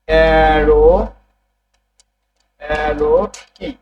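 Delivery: অ্যারো কি said slowly and carefully, each sound clear; careful pronunciation. Shown as a demonstration. Teacher slowly showing the class how to say অ্যারো. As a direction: draw out অ্যারো so students catch it. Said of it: "এটার উচ্চারণ কেমন হবে".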